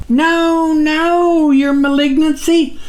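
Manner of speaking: high-pitched yell of a tiny creature; squeaky, straining yell up at a giant; tiny figure yelling. A narrator's reading. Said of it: "he yelled with what sounded like all his might".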